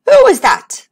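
high, loud sharp question; surprised king. Acted. King voiced in story.

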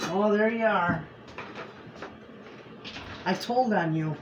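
ordinary talk, nothing marked

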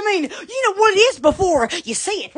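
ordinary talk, nothing marked

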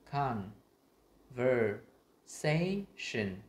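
"conversation" very slow, a gap after each syllable; separated teaching model of "conversation". Shown as a demonstration. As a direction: break "conversation" into con, ver, sa, tion. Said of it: "đọc thành tách những cái âm".

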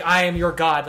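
loud, lightly acted god proclamation; mock god. Friend imagining levitating over a crowd, mock-godly proclamation. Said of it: "just be like".

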